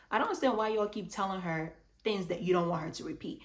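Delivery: usual speaking voice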